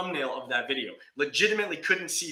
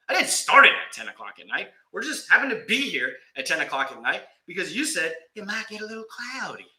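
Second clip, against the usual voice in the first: ordinary delivery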